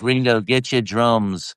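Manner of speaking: put-on a lightly done Liverpool accent; mock Liverpool accent. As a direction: drawl it like a Liverpudlian. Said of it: "I'll do more of a little Pudlian".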